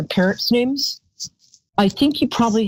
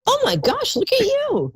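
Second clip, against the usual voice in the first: excited squeal. Real, not acted. Reacts to caller's birth year.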